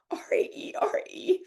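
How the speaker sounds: spelling the name through tears in a whisper; tearful whisper. Real, not acted. Host spelling her nickname, crying in a whisper. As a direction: let the tears take the voice. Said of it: "I've cried it all off".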